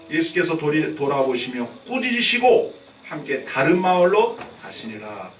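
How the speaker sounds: loud, emphatic Bible declamation; scripture reading. Acted. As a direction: proclaim the verse, stretching out the cry to the Lord. Reads the scripture aloud.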